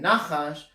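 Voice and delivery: "nachash" said NA-chash, first syllable stressed. Shown as a demonstration. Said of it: "the emphasis is on the first syllable".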